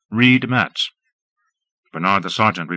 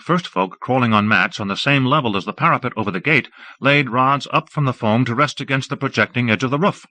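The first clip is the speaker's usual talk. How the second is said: ordinary delivery, nothing marked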